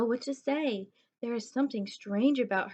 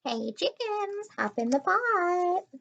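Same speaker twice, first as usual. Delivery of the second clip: slow, much higher, sing-song calling; yelling at chickens; 10 semitones higher, 3 dB louder, slower than usual. Acted. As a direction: call the chickens into the pot. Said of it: "She chases the chickens and yells".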